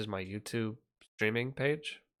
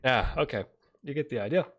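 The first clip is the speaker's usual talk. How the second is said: ordinary delivery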